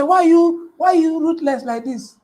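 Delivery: high, loud mock complaint; protesting client. Imitates client protesting the charge.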